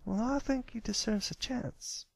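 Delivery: usual speaking voice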